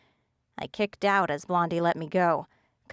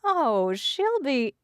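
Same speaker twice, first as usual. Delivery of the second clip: dark amusement; 7 semitones higher, much slower than usual. A narrator's reading. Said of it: "Danny chuckled darkly".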